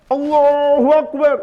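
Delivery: slow, high, forceful war cry; battle takbir. Shown as a demonstration. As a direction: call it out with full strength like charging into battle. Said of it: "Dengan kekuatan besar, takbir".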